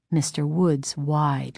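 ordinary delivery

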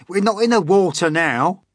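high, loud scolding; impatient centipede putting him right; scornful retort. A narrator's reading. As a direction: snap back loud and high.

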